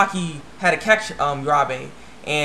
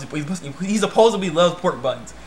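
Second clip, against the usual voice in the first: ordinary talk, nothing marked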